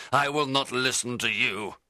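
high, loud, defiant refusal; crying out. A narrator's reading. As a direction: issue an angry challenge to an enemy. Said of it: "I cried".

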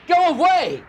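loud, high terrified shout; frightened man shouting at the ghost. A narrator's reading. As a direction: yell it in panic. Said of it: "I shouted".

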